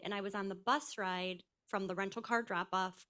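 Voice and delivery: normal talking voice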